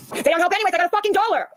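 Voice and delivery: high, incredulous outrage over a dollar; exasperated rant. Real, not acted. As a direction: yell in disbelief at the tiny tip. Angry rant about a dollar.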